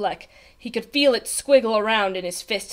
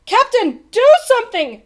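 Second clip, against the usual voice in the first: high, loud urgent plea; shouting; 12 semitones higher, 9 dB louder than usual. A narrator's reading. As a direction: shout desperately for action. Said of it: "shouted Bartholomew".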